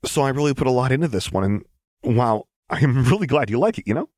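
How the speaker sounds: high and fast, tumbling out; fast high-pitched babble; nervous Jinx. Acted. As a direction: rush it with jittery relief. Jinx voiced, nervous gush.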